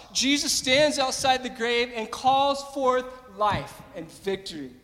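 normal talking voice

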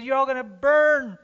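high, loud furious prophet; angry Jonah. Acted. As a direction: hurl it at the whole city in anger. Jonah voiced condemning the city.

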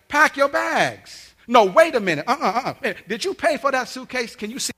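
high, loud tirade as mom; merciless angry mom. Retold or imitated. Mom tells son to pack his bags, high angry shouting. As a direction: imitate mom yelling orders at her son. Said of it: "from mercy to no mercy".

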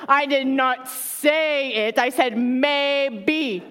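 slow, deliberate denial with stress on not; slow insistent denial. Retold or imitated. Mom denying to kids she promised ice cream, slowly. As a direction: spell it out word by word. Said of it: "I'm at the point now".

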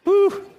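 high, loud whistle-like whew; high whew of disbelief. Real, not acted. Pastor shudders at the liquid soap idea, high whew. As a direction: blow out a shocked whew.

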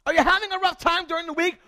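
fiery pulpit exhortation; full-force preaching. Acted. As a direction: preach it to the back row. Preaching the storm passage.

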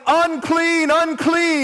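high, loud warning yell; loud, high-pitched call. Acted. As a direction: cry it out to clear the way. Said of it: "They go from yelling".

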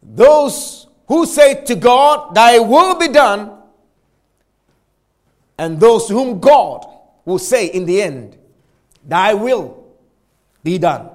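preacher restating C S Lewis to the church; emphatic preaching. Acted. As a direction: make the contrast land hard. Preacher repeating Lewis quote.

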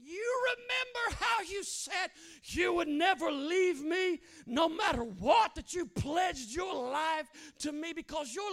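high, loud, slow impassioned plea; preaching shout. Acted. Jesus pressing Peter on the beach, loud impassioned preaching. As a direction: plead at the top of the voice.

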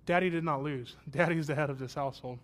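ordinary talk

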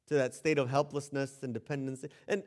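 ordinary talk

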